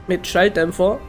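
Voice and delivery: ordinary delivery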